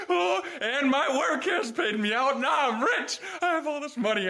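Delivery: high, giddy, laughing at the windfall; excited. Real, not acted. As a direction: rich at last, buzzing. Celebrating getting paid.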